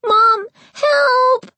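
high, loud panicked crying; calling for mom. A narrator's reading. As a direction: cry out for mom in terror. Terrified boy calling for help.

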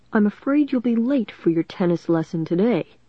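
ordinary delivery, nothing marked

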